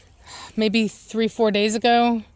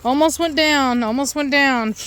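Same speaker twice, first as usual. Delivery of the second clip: startled near fall; 5 semitones higher, slower than usual. Real, not acted. Nearly falls over.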